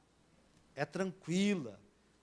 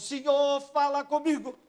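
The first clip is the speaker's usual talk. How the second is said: louder, higher, needy cry to God; mocking plea; 7 semitones higher, 7 dB louder than usual. Preacher mocking people demanding God speak, loud whiny plea. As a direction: parody a desperate begging prayer. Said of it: "Para com esse, esse negócio".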